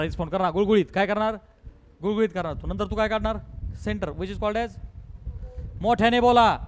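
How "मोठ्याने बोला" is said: loud call. Real, not acted. Teacher urges class to answer.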